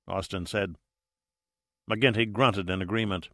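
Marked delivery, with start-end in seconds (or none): none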